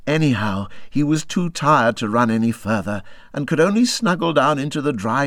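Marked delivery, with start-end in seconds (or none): none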